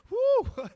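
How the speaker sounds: high, loud whoop; sudden loud "woo". Real, not acted. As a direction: let out a preacher's excited whoop. Preacher whoops mid-story.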